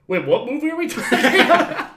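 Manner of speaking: high, loud baffled quote through laughter; laughing, exaggerated bewilderment; laughing mock confusion. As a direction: crack up while acting out your own confusion. Laughing retelling of own confusion.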